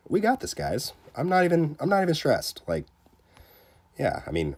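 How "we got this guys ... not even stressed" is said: high, breezy fake nonchalance; mock calm. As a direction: pretend you're totally relaxed. Feigned calm during tense upgrade.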